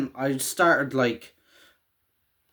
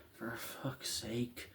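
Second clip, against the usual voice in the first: slow, exasperated whisper, much softer; Hopper's weary whisper; 3 semitones lower, 19 dB quieter, much slower than usual. Retold or imitated. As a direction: sigh it out under your breath, fed up. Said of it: "he's like".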